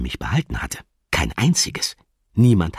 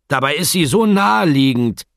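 grinning; 11 semitones higher, 8 dB louder than usual. Acted. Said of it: "grinste Steraf".

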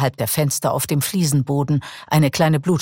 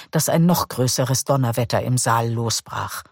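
usual speaking voice

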